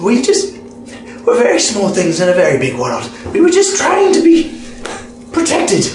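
high, pleading goblin voice; pleading, voice rising. Acted. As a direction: beg like a cornered creature.

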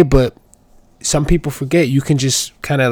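normal talking voice

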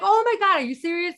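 retold gasp of disbelief, pitched high and loud; high, loud mock astonishment; shocked disbelief. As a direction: replay your shock at hearing it. Retold shock at client's story.

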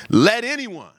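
loud proclamation. Acted. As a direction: proclaim it as if to a whole festival. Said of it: "he says in a loud voice".

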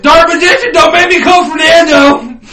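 high, loud warning; mock threatening shout. Player warning Dark Magician, mock threatening shout. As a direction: yell a hammed-up threat.

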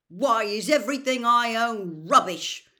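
furious high shout; loud, outraged outburst. A narrator's reading. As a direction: yell it in sheer frustration. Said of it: "said Ron furiously".

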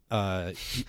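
ordinary talk

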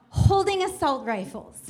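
ordinary delivery

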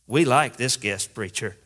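ordinary talk

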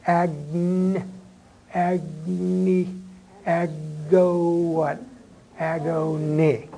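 "agni" stretched syllable by syllable toward "Agony"; slow sounding-out. Shown as a demonstration. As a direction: draw out "Agn-, agni, ago" as held, leading sounds. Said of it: "I wanna emphasize this first part".

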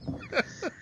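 shrill squealing laugh; high squeal laugh. Real, not acted. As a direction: squeal with delight at the joke. Laugh at Fran Drescher joke.

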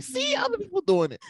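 high mock voice of his wife. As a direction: play the wife, high and teasing. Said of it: "my wife will be like".

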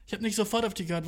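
usual speaking voice

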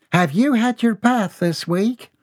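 a fussy mother's question; high mother's voice asking. A narrator's reading. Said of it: "mother questions son".

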